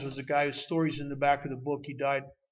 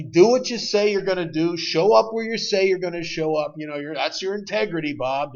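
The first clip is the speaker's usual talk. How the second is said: mock slogan rant; 3 semitones higher, 10 dB louder than usual. Mock-quoting program slogans with emphasis.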